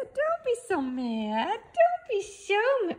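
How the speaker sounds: high pet-talk soothing. Real, not acted. Owner soothing her grumpy dog in baby talk.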